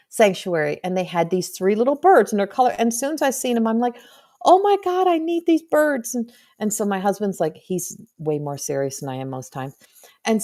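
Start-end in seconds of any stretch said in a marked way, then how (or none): 4.4-6.1 high-pitched, delighted outburst; mock-excited squeal of delight, much higher; excited gushing. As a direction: gush over something you must have. Excited reaction on seeing birds.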